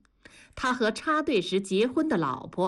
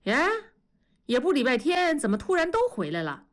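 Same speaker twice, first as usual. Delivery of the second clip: mild astonishment, starting high; walk in and wonder aloud, taken aback; surprised; 5 semitones higher, faster than usual. A narrator's reading. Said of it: "惊讶地说". (惊讶地问)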